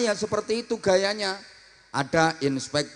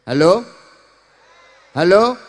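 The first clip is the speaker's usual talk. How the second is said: louder, rising puzzled hello; confused host; 8 dB louder than usual. Shown as a demonstration. Confused host answering the door, rising puzzled hello. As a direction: call out unsure who's there. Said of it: "Bingung tuan rumahnya".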